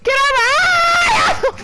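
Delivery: high, loud, slow yell; drawn-out squealing shout; shouted order. Real, not acted. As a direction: roar it at the dog to move. Yelling at in-game dog.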